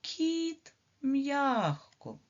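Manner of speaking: teacher demonstrating a soft consonant; soft kit. Shown as a demonstration. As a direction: stretch кіт to show softness. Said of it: "а у слові".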